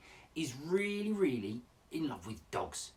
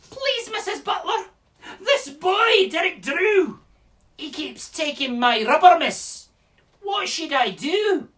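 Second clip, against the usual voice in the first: high, loud Scottish schoolchild; Scottish accent; 12 dB louder, slower than usual. Acted. Scottish pupil telling Missus Butler about Derek Drew, high plaintive accent. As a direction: complain to the teacher in a high Scottish child's voice. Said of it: "this is my best Scottish accent".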